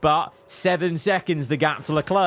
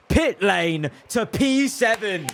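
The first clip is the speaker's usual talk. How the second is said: excited shout; 6 semitones higher, slower than usual. Real, not acted. Team boss celebrating his driver's comeback, shouting. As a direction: belt it out like a commentator.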